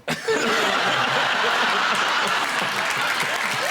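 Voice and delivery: airy laugh; breathy laughter. Real, not acted. Guest laughing about the king's grass skirt, airy laugh. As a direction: chuckle breathlessly.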